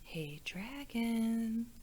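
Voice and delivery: soft, slow whisper; whispered very softly. Acted. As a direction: barely breathe it so as not to wake him. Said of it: "and whispered very softly".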